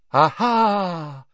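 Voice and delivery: high, loud dramatic exclamation; triumphant cry. A narrator's reading. Trelawney confronts Harry at the door, dramatic cry. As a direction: cry out as if catching someone. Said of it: "she cried".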